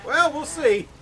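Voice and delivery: loud, high excited yell; excited shout. Real, not acted. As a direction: burst out at full volume. Sudden outburst while racing.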